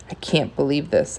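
ordinary delivery, nothing marked

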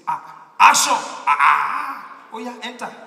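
a loud, long drawn-out "ah" of sudden understanding; excited realisation. Retold or imitated. Driver finally grasps what the visitor wants, loud excited cry. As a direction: bellow it slowly, delighted to finally get it.

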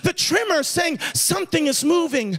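impassioned preacher driving the point home; preaching. Real, not acted. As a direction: preach it insistently, building in intensity. Preaches a warning to the congregation.

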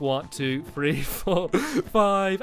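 low, mechanical monotone count; robotic chant on a repeating loop. Real, not acted. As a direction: count like a machine, evenly spaced. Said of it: "I start sounding like a robot".

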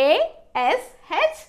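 very slow, letter by letter; spelling out. Shown as a demonstration. Teacher spelling a word for learners, slowly letter by letter. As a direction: spell it with long gaps between letters.

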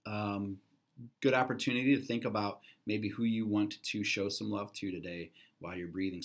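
ordinary delivery

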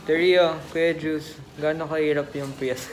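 muffled masked speech; talking behind mask; through a face mask. Real, not acted. Seen 2: Vlogger in foreground wears blue surgical mask over mouth throughout.

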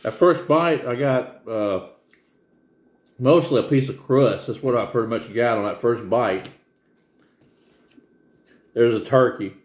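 talking while chewing; eating pizza while speaking; mouth full. Real, not acted. Seen 0: Holds pizza slice near mouth, chewing between bites throughout labelled words.

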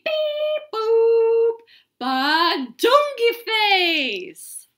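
loud goofy nonsense babble; silly sounds. Acted. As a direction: shout the gibberish with glee. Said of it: "make silly sounds like".

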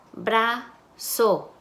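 Brazo split as "bra... zo" with a pause; syllable by syllable. Shown as a demonstration. Teacher blending syllables for students, syllable by syllable. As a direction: break Brazo into two separate syllables. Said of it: "van a segmentar sílabas".

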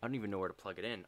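rushed, flustered under the breath; fast mutter. Real, not acted. Streamer hunting for the cable with the controller dead, fast and flustered. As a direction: rattle it off fast while searching.

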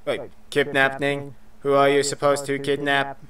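even-pitched robot questioning. Acted. Robot questioning the kidnapper, monotone robot voice. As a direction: ask it in a measured robot monotone. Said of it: "try and do that robot voice".

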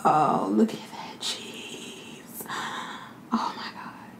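slow, hushed whisper of food awe; awed whisper. Real, not acted. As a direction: whisper it, savouring every word. Hushed awe at the cheese pull.

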